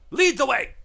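high, loud proud exclamation; rallying cry. Acted. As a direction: belt it out like a patriotic slogan.

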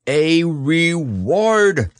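high, drawn-out proclamation; very loud. A narrator's reading. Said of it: "said Owl very loudly".

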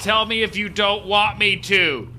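slow teasing call. Acted. Taunting the meditating lady.

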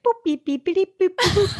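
high playful boop beep noises; chirpy mock computer beeps; beeping sound effect. Imitating map travel sound effects.

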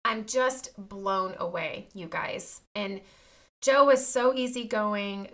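usual speaking voice

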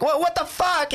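very high, imitated disbelief; mock shocked outburst. Imitating the girls' reaction in California, mock shocked outburst. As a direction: squeal it like an appalled bystander.

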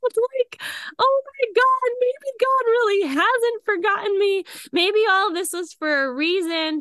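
high, loud tearful cry of relief; tearful disbelief. Retold or imitated. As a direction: let it break into tears of gratitude. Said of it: "I just start crying".

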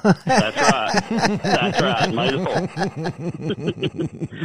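laughing. Real, not acted. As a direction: chuckle it out. Laughs at May the fourth pun.

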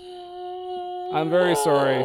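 high, slow drawn-out apology; mock apologetic. Real, not acted. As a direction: stretch the sorry out sweetly. Apology after harsh rating.

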